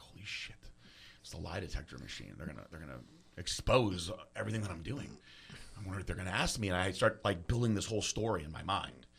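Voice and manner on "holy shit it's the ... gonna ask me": low, very soft inner monologue; hushed dread. Retold or imitated. Tripping suspect eyeing a machine, hushed dread. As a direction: think it aloud under your breath. Secret panicked thoughts at police station.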